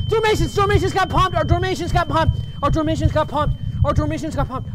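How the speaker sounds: high, urgent cry settling toward normal; frantic chant that gradually comes down; pained shouting, easing. Acted. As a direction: shout for relief, calming with each repeat. Screamed escape from pain, easing off.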